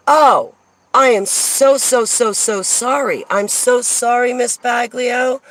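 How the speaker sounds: high, drawn-out profuse apology; gushing apology. Retold or imitated. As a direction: act out a clerk apologizing all over herself. Clerk's profuse apology, acted.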